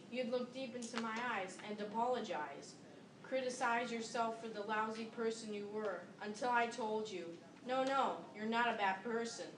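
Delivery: ordinary talk, nothing marked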